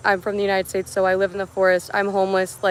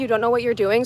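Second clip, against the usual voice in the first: ordinary delivery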